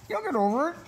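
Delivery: very high sing-song reassurance; high coo. Real, not acted. Reassuring the nervous dog in a very high coo.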